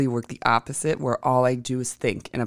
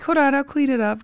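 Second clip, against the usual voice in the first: high, helpful bellhop voice; lightly voiced eager bellhop; imitating bellhop; 12 semitones higher, 3 dB louder than usual. Bellhop offering to clean up the cockroach, high and eager. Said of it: "he's like".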